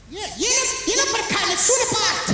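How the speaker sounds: lilting high mock mother voice; mother waving it off in a high lilting voice; high singsong mother. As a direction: sing it back like a dismissive mother. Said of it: "mother answers".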